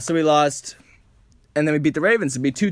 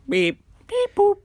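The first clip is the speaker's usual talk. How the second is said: robot beeps; slower than usual. Pretend. Robot noises joke.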